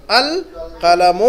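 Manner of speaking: القلم stretched very slowly, each sound held; teacher slowly modelling القلم for students; drawn-out model reading. Shown as a demonstration. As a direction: draw out القلم for learners to repeat. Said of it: "اس کو کیسے پڑھیں گے؟".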